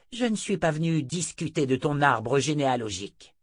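scornful witch questioning; imperious seer. Acted. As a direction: press him in a high, stern voice. Narrator voices Chota the seer.

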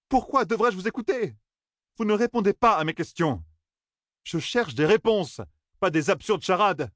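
high-pitched, heated protest; exploding in indignation. A narrator's reading. Young man demanding answers instead of riddles, indignant outburst. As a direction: fire it off, exasperated, pitched high. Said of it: "explosa-t-il".

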